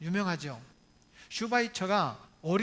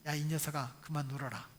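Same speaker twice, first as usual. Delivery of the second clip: soft, low murmur of conscience; hushed, gentle admonition; calm inner voice; 5 semitones lower, 7 dB quieter than usual. Retold or imitated. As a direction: quote a still, small voice, fading softer. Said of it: "잔잔한 음성이 있었어요".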